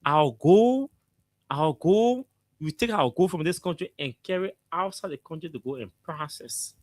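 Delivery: ordinary talk, nothing marked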